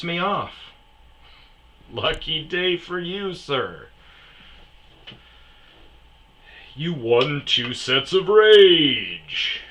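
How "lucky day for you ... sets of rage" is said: slow, hammed-up prize announcer, louder; mock game-show host.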